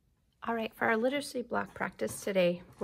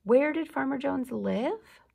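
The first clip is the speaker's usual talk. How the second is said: higher, louder bright question; excited question; 6 semitones higher, 5 dB louder than usual. Acted. Teacher asks child an eager story question. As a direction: ask it with big eagerness.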